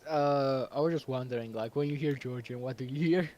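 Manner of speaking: ordinary talk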